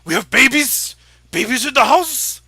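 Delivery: thrilled to see little ones; excited orc woman. Acted. Orc wife spotting the adventurers, excited and loud. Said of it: "She looks at all of you and goes".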